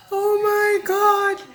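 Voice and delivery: very high, drawn-out squeal; mock exasperation. Recapper fed up with castmates' drama, high mock wail. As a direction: stretch it out in a high put-on voice.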